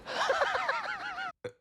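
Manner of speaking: a real laugh shooting high; an amused high laugh; laughing in disbelief. Real, not acted. Laughs at the mistress line.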